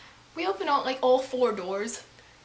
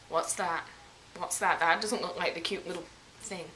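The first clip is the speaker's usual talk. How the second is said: lower, hushed nervous question; staring at something scary, uneasy; 7 semitones lower, 4 dB quieter than usual. Retold or imitated. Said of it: "I'm like".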